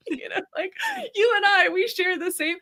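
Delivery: high and loud, tearful wonder; crying and going. Retold or imitated. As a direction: plead tearfully with a plant. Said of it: "crying and going".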